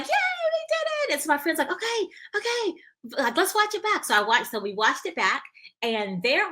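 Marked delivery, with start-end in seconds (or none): 0.0-1.1 very high, drawn-out cheer; retold high-pitched "yay"; celebrating. Retells the group's celebration. 1.7-4.0 high-pitched, eager okay; retold excitement, pitched way up; excited friend. Voices her excited friend.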